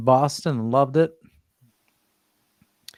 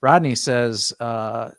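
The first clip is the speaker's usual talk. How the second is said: normal talking voice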